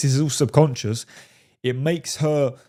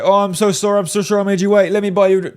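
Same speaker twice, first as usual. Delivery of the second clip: apologetic eager guy; 6 semitones higher, 4 dB louder, faster than usual. Retold or imitated. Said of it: "He doesn't say".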